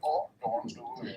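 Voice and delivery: breathy whispered warning. Real, not acted. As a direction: whisper it under your breath. Whispered aside in chat.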